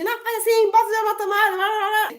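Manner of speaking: high, mocking sing-song nag; a high, teasing imitation of a nagging parent. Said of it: "nessa de".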